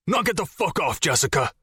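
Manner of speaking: put-on furious outburst at his girlfriend; angry yell. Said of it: "Jason's voice echoed".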